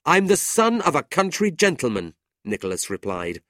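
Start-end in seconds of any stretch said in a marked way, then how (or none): 0.1-2.2 high, loud, proud retort; indignant. A narrator's reading. Brother answering gentleman's insult, indignant. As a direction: assert your birth with heat.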